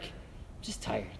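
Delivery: low, soft, drained "I'm just tired"; half-voiced weary reply; weary mumble. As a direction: sigh the words out, worn down.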